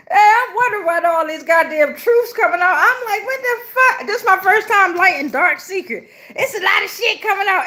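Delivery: high-pitched talk through real laughter; laughing. Real, not acted. Reader amused by the incense coincidence, laughing high-pitched.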